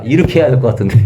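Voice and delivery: ordinary talk, nothing marked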